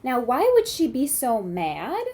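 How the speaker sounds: ordinary delivery, nothing marked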